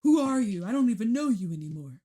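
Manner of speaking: mock outraged accusation. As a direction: play a wounded friend calling you out. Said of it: "Some people are like".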